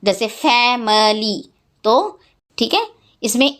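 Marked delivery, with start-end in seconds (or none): 0.3-1.5 फैमिली slowly split as fa, mi, lee; syllable by syllable. Shown as a demonstration. Teacher giving an example of syllables, फैमिली broken apart. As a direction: say फैमिली in three separate beats. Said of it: "Every syllable has at least one vowel".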